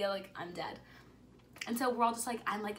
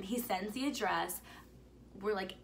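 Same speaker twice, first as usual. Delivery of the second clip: ordinary talk, nothing marked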